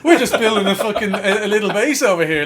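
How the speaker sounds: bright, loud, laughing hello to Bill; excited mock greeting. Boys cheerily greeting the odd neighbour, laughing hammed-up hello. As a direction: play it up with a laugh in the voice.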